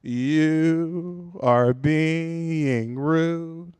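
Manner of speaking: extremely slow, labored accusation; mock impaired speech, very drawn out; stroke-slowed speaker. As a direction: drag out every syllable. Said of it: "Because I had a stroke".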